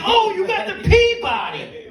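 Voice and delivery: high mock-impressed sarcasm; sarcastic. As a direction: fake delight, dripping irony. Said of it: "I'm like".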